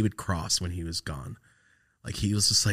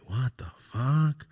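drawn-out, higher-pitched disbelief; retold stunned reaction, slowly; disbelieving; 5 semitones higher, much slower than usual. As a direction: stretch it out in mock bafflement. Retelling his disbelief at a call.

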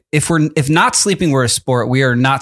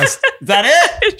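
laughing; slower than usual. Real, not acted. Laughing after the song.

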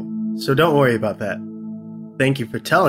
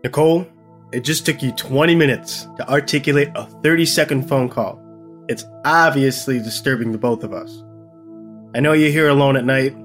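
low, firm male voice; deep-voiced, insistent reasoning; stern boyfriend; 8 semitones lower than usual. A narrator's reading. Boyfriend urging girlfriend to take the threat seriously, stern. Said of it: "His face becomes stern".

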